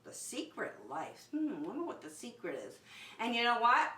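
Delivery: usual speaking voice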